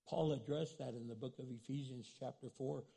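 ordinary talk

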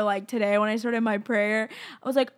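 ordinary talk

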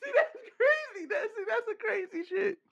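high, squeaky laughing speech; laughing while talking. Real, not acted. Cracking up at the remark.

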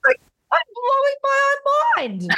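high, slow, amazed squeal; mind blown. Real, not acted. To the host, squealing at her own big revelation.

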